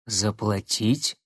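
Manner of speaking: медленный озадаченный переспрос; тянет слово, не понимая; недоуменно. Acted. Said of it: "недоуменно переспросил тот". (недоуменный вопрос)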